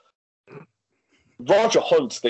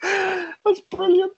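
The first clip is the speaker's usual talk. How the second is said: high, drawn-out laughing words; helpless laughter; much slower than usual. Real, not acted. Amused by the biased commentator story, laughing helplessly. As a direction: squeeze it out through real laughter. Cracks up at the magazine claim.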